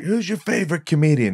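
high-pitched cocky impression; Tom Stade. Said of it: "you doing an impression of him".

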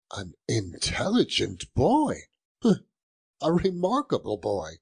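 chuckling, eager, climbing in pitch; giddy delight. Acted. Scrooge asks the boy about the prize turkey, giddy delight. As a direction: bubble over with little laughs.

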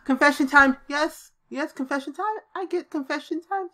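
high-pitched gleeful cheer; thrilled gamer cheering a breakthrough; excited. Real, not acted. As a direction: squeal with delight at the win. Gleeful at getting confession.

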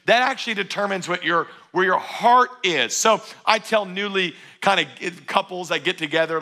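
ordinary talk

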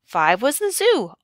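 ordinary delivery, nothing marked

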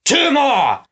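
high-pitched commanding shout; bellowed. A narrator's reading. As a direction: bellow the count to your henchmen. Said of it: "Snatcher bellowed".

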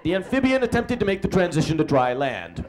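ordinary talk

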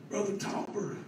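softly imitated tearful call; retold weeping plea. Crying man phones his preacher, softly imitated. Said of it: "all I heard was somebody crying".

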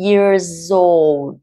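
put-on "Years old" linked, the s running into old; linking demo. Shown as a demonstration. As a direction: join "Years" straight onto "old". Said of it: "Years và nối với O ở đằng sau". (nối âm "Years old" liền mạch)

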